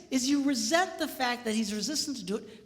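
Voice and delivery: ordinary talk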